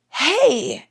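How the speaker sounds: gasp, then a high-pitched hey; mock excited greeting. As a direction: imitate an excited fan saying hello. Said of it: "he comes up all excited".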